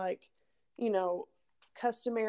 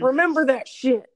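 higher, louder catchphrase; loud sign-off shout; 5 semitones higher, 11 dB louder than usual. Real, not acted. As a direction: belt the catchphrase out. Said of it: "co-host echoes sign-off loudly".

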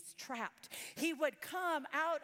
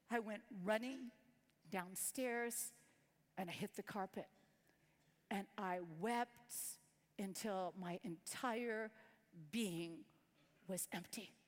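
sorrowful confession; 6 semitones lower, 5 dB quieter than usual. Real, not acted. Pastor's wife confesses to congregation, low and grieving. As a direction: let it sink, quiet and broken. Confession of nearly hurting son.

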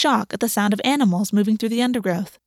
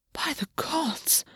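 slow, airy breath of awe, much softer; 6 semitones higher, 9 dB quieter, much slower than usual. A narrator's reading. August awed by Gwen's power, breathed slowly. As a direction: let it out on a breath. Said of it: "August breathed".

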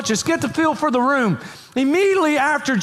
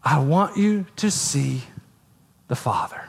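hushed quote; 8 semitones lower, 3 dB quieter than usual. Acted. Jesus voiced, intimate quoting.

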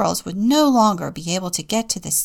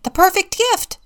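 excited; 12 semitones higher, 7 dB louder than usual. A narrator's reading. Squirrels' excited discovery.

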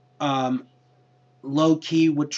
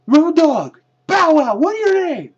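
high, loud mock yell; shrill-free loud imitation of a trainer yelling names; yelled quote; 11 dB louder than usual. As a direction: shout it out like an angry trainer. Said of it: "he yells".

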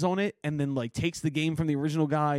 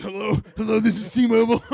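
higher, louder, booming telemarketer greeting; Kevin Hart telemarketer bit; 5 semitones higher, 7 dB louder than usual. Retold or imitated. Comic imitating a huge telemarketer answering calls, booming. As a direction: boom out the call-center hello. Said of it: "He's like".

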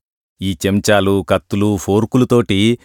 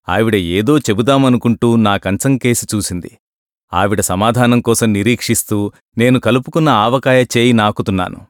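usual speaking voice

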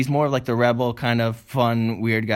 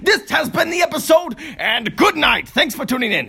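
announcer outro; 7 semitones higher than usual. Acted. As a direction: hype it like a show host.

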